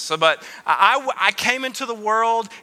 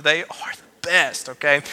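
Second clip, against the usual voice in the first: whisper; 4 semitones lower than usual. Acted. Stage-whispered aside to congregation.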